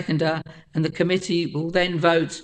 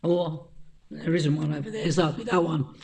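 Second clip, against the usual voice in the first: hoarse, croaky throat; 6 dB quieter than usual. Real, not acted. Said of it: "excuse my croaky voice this evening".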